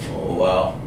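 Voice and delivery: ordinary talk, nothing marked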